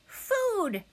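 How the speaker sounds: high-pitched hungry peep; high, insistent hatchling cry; tiny gosling voice. A narrator's reading. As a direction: cry out like a baby bird demanding food. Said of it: "The tiny voice".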